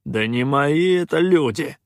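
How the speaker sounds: loud, slow, exasperated denial; irritated. A narrator's reading. Pirate disowns the mutineers, irritated. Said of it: "раздражённо сплюнул Калаан".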